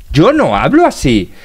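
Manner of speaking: ordinary talk, nothing marked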